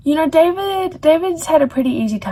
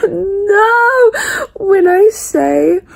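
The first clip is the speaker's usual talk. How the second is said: crying; 7 semitones higher, 4 dB louder, much slower than usual. Real, not acted. Grieving a killed character.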